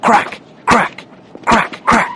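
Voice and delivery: sharp, high cracks like gunfire; gunshot sounds. A narrator's reading. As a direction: snap each crack out like a shot. Narrator imitates the gunshots.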